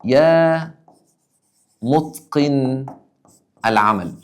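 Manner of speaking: drawn-out words, very slow; slow demo. Shown as a demonstration. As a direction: lay each word out slowly for the students. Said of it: "تبقى". (نطق بطيء وممدود)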